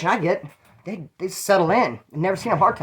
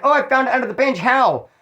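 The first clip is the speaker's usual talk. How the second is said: higher, put-on astonished voice; mock surprise; 7 semitones higher, 4 dB louder than usual. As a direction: fake being amazed at the find. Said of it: "I did the acting like".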